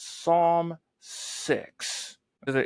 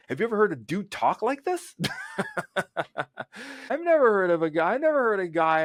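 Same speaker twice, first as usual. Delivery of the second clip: amused laughing question; 7 semitones higher, faster than usual. Real, not acted. Amused at David's emotional language.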